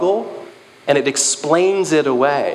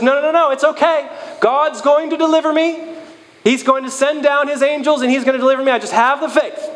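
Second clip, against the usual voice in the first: cheery stubborn refusal; 12 semitones higher than usual. Retold or imitated. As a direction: insist brightly God will save you. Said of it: "He says".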